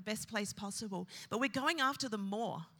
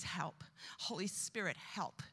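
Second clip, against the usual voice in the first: softly whispered plea, much softer; whispered prayer; 3 semitones lower, 6 dB quieter than usual. Shown as a demonstration. Preacher showing the congregation a whispered cry for help. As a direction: breathe a desperate little prayer. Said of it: "one of the best things you can say is".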